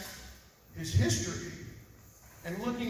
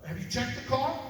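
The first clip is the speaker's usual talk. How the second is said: alarmed family; 6 semitones higher, 6 dB louder than usual. Retold or imitated. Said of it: "This family right here goes".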